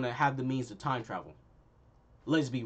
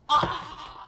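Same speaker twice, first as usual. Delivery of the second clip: high, short yelp of a scream; a much higher mocking "Ah!" scream; 13 semitones higher, 4 dB louder than usual. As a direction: imitate her cry as the blast hits. Imitating her power blast scream.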